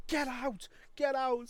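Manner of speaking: high, loud shooing out; angry boss. Retold or imitated. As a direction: imitate the owner ordering him out. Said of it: "he was like".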